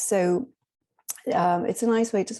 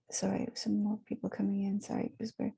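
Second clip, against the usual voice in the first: hushed whisper to the room; quick whispered apology; whispered aside; 8 dB quieter, faster than usual. Real, not acted. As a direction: drop to a whisper so as not to disturb. Aside as latecomers arrive.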